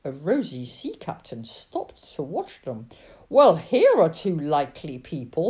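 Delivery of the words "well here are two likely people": loud, hearty captain's greeting; booming exclamation; crying out. A narrator's reading. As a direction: hail them in a big voice. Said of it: "he cried".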